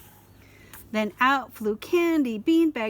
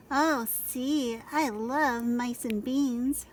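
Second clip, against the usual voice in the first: slow character voice; 2 semitones higher, much slower than usual. A narrator's reading. Scapito slow voice.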